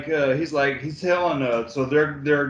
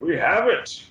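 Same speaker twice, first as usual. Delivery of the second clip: mock triumph; 4 semitones higher than usual. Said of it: "they're all like".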